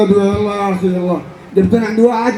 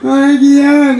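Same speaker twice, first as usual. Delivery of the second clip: loud, mocking repeat; fed-up, booming echo; exasperated echo; 8 dB louder than usual. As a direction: throw the complaint back loudly. Exasperated echo of hungry friend.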